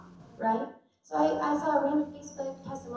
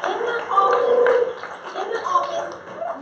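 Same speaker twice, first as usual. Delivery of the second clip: much higher and louder, laughing it out; triumphant laugh; 8 dB louder than usual. Real, not acted. Celebrating chiropractic's power to the crowd, laughing triumphantly. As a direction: exult over the punchline.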